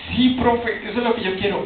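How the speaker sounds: student perks up at the professor's idea, eager; eager yes. Retold or imitated. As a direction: jump in keen and hopeful.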